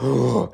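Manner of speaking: sharp shocked gasp. Retold or imitated. TikTok girl reacting to Carter's answer, gasping. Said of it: "like, literally freaking gasps".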